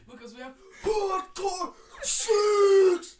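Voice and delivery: huge high drawn-out scream; absurdly over-intense roar; intense shouting. Acted. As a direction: bellow it slowly at full blast. Repeats intense act, louder.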